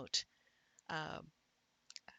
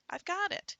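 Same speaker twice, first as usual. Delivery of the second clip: pretend recognition; 12 semitones higher than usual. Acts reader recognizing the word.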